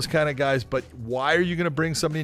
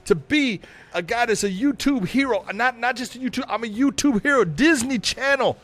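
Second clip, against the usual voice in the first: Jake Paul impression; 4 semitones higher than usual. Mock press conference, Jake taunting Conor in a brash influencer voice. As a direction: hype himself up and taunt the rival. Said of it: "[name], you're gonna play Jake".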